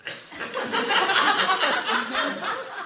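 high rising laugh. Real, not acted. Pastor laughing at his wife's calm faith, high laugh.

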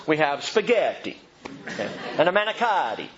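hammed-up Italian accent on the food words; mock Italian accent. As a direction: say spaghetti and manicotti in an over-the-top Italian accent. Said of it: "you really need to do it with an accent".

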